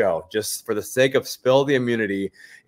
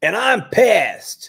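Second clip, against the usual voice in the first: high, loud, drawn-out mock outrage; exaggerated impression of an angry contestant; imitating former contestant; 11 semitones higher, 7 dB louder, much slower than usual. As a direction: mimic her being pissed, slow and loud. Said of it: "the lady who, like, had a million job titles".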